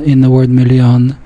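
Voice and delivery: usual speaking voice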